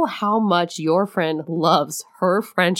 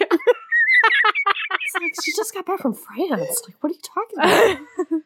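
laughing confusion; 11 semitones higher, slower than usual. Real, not acted.

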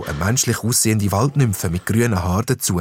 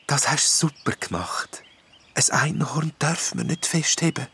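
soft, breathy whispered fairy voice; gentle wood nymph; 6 semitones higher, 6 dB quieter than usual. A narrator's reading. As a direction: soothe the jester kindly.

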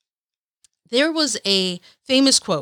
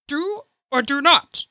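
terrible Yoda; 4 semitones higher than usual. Retold or imitated. Vlogger voicing Yoda's line in a bad impression, pitched up. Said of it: "Terrible accent".

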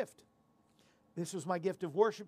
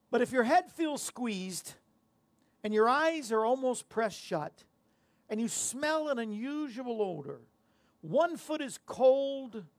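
joke setup; 4 semitones higher, 4 dB louder, slower than usual. Acted. Setting up a riddle joke.

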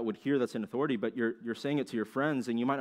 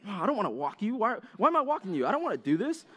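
high-pitched mock whine; put-on, high moan of protest; whiny complaining; 9 semitones higher than usual. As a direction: grumble reluctantly at the dog. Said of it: "you're complaining and you're even".

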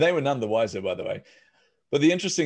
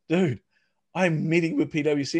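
alarmed; 7 semitones higher, 4 dB louder than usual. Retold or imitated. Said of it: "I'm like".

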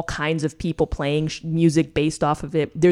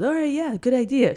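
much higher, goofy eager agreement; dazed eager king; 8 semitones higher than usual. Retold or imitated. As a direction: play him gullible and brainwashed. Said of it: "And he goes".

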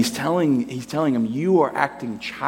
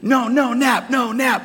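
put-on upset toddler; 12 semitones higher, slower than usual. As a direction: wail no like a cranky child fighting a nap. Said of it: "if he's upset and he's angry".

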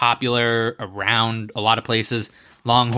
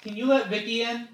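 calling out; 9 semitones higher, 4 dB quieter than usual. Real, not acted. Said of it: "yell down to my mom or dad".

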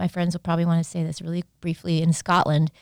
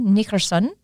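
put-on another go at a Scottish accent, louder; Scottish accent, asking; Scottish accent again; 4 semitones higher, 6 dB louder than usual. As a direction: repeat Nickerson in a mock Scottish accent. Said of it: "Was that good?".

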